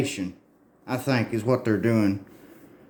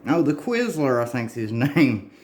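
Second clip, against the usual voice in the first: usual speaking voice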